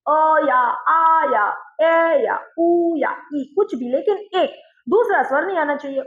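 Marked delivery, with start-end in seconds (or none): 0.1-3.4 each vowel of "अ या आ या ए या उ या ई" held long and slow; slow vowel-by-vowel demo of "अ, आ, ए, उ, ई"; drawn-out vowels. Shown as a demonstration. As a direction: sustain every single vowel. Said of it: "स्वर एक ही होना चाहिए".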